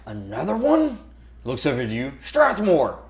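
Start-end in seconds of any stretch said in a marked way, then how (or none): 0.0-1.0 high, questioning captain's voice; incredulous captain. Acted. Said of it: "he looks over the edge and he goes". 2.2-3.0 high summoning call; captain calling out. Acted. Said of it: "The captain walks over there".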